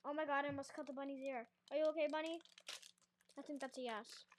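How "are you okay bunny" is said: louder, worried check on the toy bunny; worried call to bunny. Real, not acted. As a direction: ask the bunny if it's hurt, a little alarmed.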